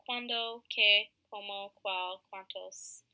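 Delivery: slow, deliberate reading of cuando, qué, cómo, cuál, cuántos; teacher slowly modelling Spanish question words; slow careful Spanish. Shown as a demonstration. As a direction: spell each question word out slowly for students. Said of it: "examples would be".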